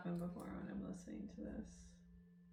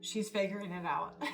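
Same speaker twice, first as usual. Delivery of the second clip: laughing; 3 semitones higher than usual. Real, not acted. Proud mother laughing.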